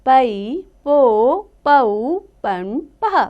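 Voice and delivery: slow, drawn-out teacher's chant of each syllable; slow syllable recitation. Shown as a demonstration. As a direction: sound out every syllable slowly for children. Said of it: "अब हम पढ़ेंगे प की बारह खड़ी". (धीमे-धीमे अक्षरों का उच्चारण)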